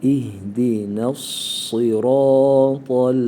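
İhdina with thin n, sıratal with heavy s, chanted slowly; correct recitation. Shown as a demonstration. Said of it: "nunu ince okuyacağız, satı kalın".

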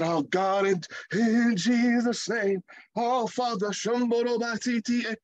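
preacher parodying showy prayer; mock fervent prayer. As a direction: lampoon an over-spiritual prayer.

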